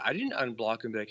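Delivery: usual speaking voice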